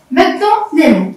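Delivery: ordinary talk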